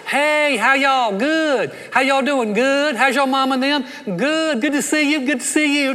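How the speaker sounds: high, loud sing-out Southern greeting; gushing Southerner. Retold or imitated. Southerner greeting folks to their face before gossiping, gushing mock politeness. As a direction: greet them with overdone Southern friendliness. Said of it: "You ever met someone from the South?".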